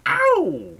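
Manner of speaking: very high, falling ow; yelp. Real, not acted. Streamer yelps mid-stream, a high falling ow. Sudden yelp after joking.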